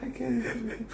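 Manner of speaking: high, half-laughing fake sob; mock sobbing. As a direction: ham up a tearful breakdown while laughing. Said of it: "never emotionally sat down and cried".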